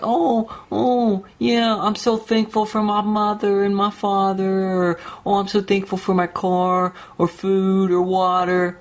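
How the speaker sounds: high, sugary mocking whine; mock grateful voice. Ranting at fake-thankful people, high mocking whine. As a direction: parody a fake-thankful poster.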